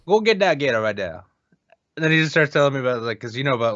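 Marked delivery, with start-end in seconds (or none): 0.1-1.2 raised-pitch mock Cajun; Cajun accent. Shown as a demonstration. Roommate repeating the practice phrase, Cajun accent. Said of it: "Cajun accent".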